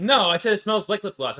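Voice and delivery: high, loud, slow protest; indignant correction; loud defensive. Real, not acted. Defending herself loudly.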